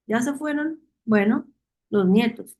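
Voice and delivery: ordinary delivery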